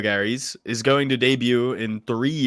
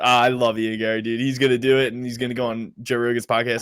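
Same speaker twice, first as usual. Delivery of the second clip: ordinary talk, nothing marked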